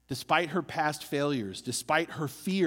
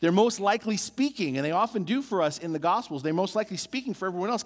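ordinary delivery

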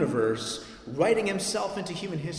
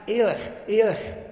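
raised anguished cry, higher and louder; a dying man crying to God, pitched up; 6 semitones higher, 4 dB louder, slower than usual. Retold or imitated. Pastor voicing Jesus on the cross, a raised anguished cry. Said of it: "So Jesus is crying".

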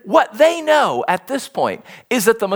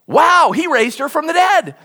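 amazed wow; 7 semitones higher, 3 dB louder than usual. Pretend. Imagined amazed mourner.

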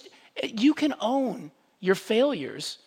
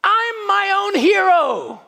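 high, loud mocking singsong; mock Elsa; 12 semitones higher, 9 dB louder, slower than usual. Preacher mocking Frozen two's punchline, high mock Elsa. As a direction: send up the self-love anthem, much higher and louder. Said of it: "The punchline of Frozen two".

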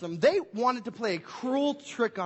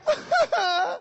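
mock wail; 11 semitones higher, 5 dB louder than usual. Retold crying at empty box.

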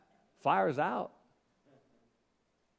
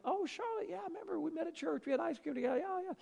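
Vicky on the phone; 14 semitones higher, 4 dB quieter than usual. Retold or imitated. Vicky answering the phone, voiced.

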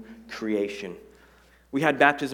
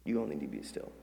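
much softer and lower, letting it sink in; soft repeat; 8 semitones lower, 9 dB quieter, faster than usual. Shown as a demonstration. Pastor repeating the line softly to the church. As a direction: hush it down so it lands. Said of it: "Maybe some of y'all need to hear that".

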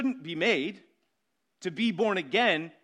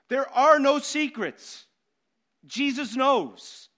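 emphatic; 5 semitones higher, 5 dB louder, slower than usual. Real, not acted. Preacher driving home a point.

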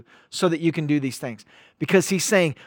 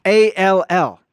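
"A L L" spelled slowly, each letter louder and punched; 6 dB louder than usual. Shown as a demonstration. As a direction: spell "A L L" like typing in caps. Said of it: "but put all caps".

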